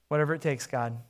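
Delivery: low, soft plea to God; hushed surrender. Acted. Desperate listener surrenders to God, hushed and low. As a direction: pray it quietly, giving in.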